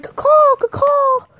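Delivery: very high, loud cawing; imitated crow call. Wrestler figure announces himself, high mock crow cries. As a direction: caw like a shrieking bird. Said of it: "Sting's all like".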